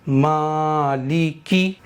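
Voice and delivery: मालिकी with the मी stretched one alif long, ल and की short; slow tajweed recitation, only the मी held; Quran recitation demo. Shown as a demonstration. Teacher reciting for students, slowly drawing out मी. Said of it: "एक बार और सुन लीजिए".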